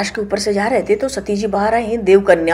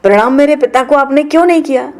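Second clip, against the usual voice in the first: indignant Sati; 6 semitones higher, 4 dB louder than usual. Acted. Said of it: "सती जी कहती".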